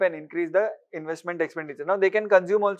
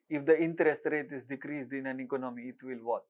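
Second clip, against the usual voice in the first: a lightly put-on Russian accent, lower and softer; fake Russian accent; 5 semitones lower, 8 dB quieter than usual. Shown as a demonstration. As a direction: read the question in a hammed-up Russian accent. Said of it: "This is the fake Russian accent".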